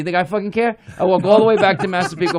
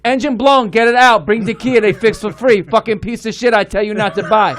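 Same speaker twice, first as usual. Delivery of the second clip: Chinese accent impression; 2 semitones higher than usual. Chinese mechanic scolding him about the Kia, Chinese accent. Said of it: "This is exactly how he talks".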